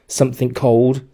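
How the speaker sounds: ordinary delivery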